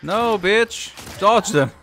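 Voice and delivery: high, loud yell in the heat of a fight; alarmed shout. Real, not acted. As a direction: shout it urgently at the game, pitch jumping up. Game attack, panicked shouting.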